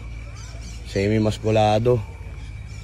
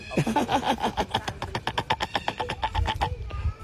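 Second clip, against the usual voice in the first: high squealing laugh; 11 semitones higher, 11 dB quieter than usual. Real, not acted. Laughing after taunting kangaroo.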